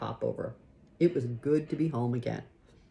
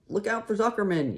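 yelled warning; 7 semitones higher than usual. A narrator's reading. Gander warning Wilbur of the farmer, high mild yell. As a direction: raise the pitch in a falling warning. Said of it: "yelled the gander".

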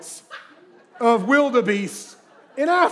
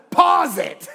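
put-on higher, louder, eager kid's voice; mock little boy; 7 semitones higher, 6 dB louder than usual. Son telling brother to pause the TV, eager kid voice. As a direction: play the excited little brother. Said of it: "My other son says".

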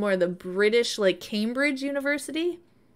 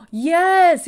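excited gasp and cheer; 8 semitones higher, 10 dB louder than usual. Real, not acted. Excited by game update news.

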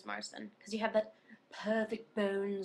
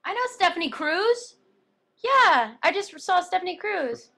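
loud, high, thrilled burst; excited; 8 semitones higher, 10 dB louder than usual. Real, not acted. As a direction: gush about someone you know. Excited reply to a fan comment.

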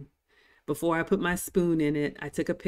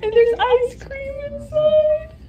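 high, loud, tearful squeal; nearly crying; 9 dB louder, slower than usual. Real, not acted. Vlogger almost crying over the dessert reveal. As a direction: choke back happy tears. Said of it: "that's me trying not to cry".

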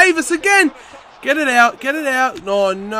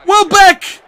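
high, loud cries at the near miss; excited shout; 10 semitones higher, 7 dB louder, slower than usual. Real, not acted. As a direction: yell as the shot flies by.

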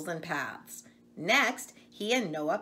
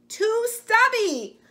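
much higher, louder, slow whine; upset calf; 10 dB louder, slower than usual. Acted. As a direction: drag it out like a sulky little calf. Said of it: "cried Fergus".

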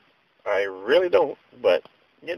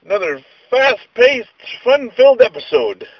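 mock show host; 11 semitones higher, 6 dB louder than usual.

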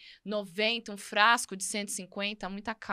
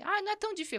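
high-pitched, softer little protest; mock exclamation; 10 semitones higher, 6 dB quieter, much faster than usual. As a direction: toss it off high and light. Acting out the spoon practice. (exclamação encenada, em tom agudo)

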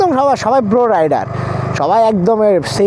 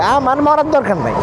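ordinary talk, nothing marked

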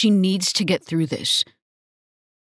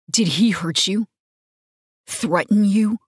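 hard voice; 3 semitones higher than usual. A narrator's reading. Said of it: "my voice coming out hard".

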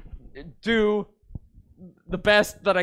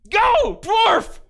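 cheering shout; 11 semitones higher, 5 dB louder than usual. Acted. Cheering the dwarf.

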